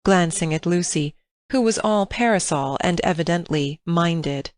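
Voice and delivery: plain reading voice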